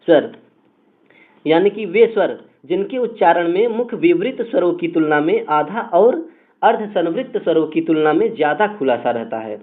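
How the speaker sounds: ordinary talk, nothing marked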